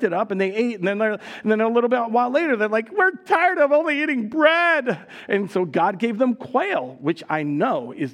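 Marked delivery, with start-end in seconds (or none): 2.9-5.1 high, slow whining gripe; whiny complaint. Retold or imitated. Israelites griping to God about only eating manna, high whine. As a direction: gripe like a spoiled child.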